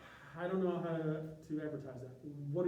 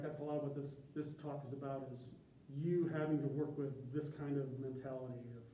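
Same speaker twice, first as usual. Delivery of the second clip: ordinary delivery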